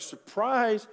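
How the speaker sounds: mock surprise. Jacob wakes to find Leah.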